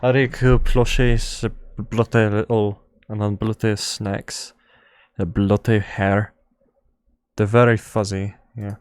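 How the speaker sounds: put-on playful British accent. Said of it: "British accent".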